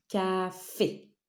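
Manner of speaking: "Café" in two separate syllables, CA then FÉ. Shown as a demonstration. Teacher showing the second syllable, drawn out. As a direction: set FÉ apart as the second syllable. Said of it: "sur la deuxième syllabe".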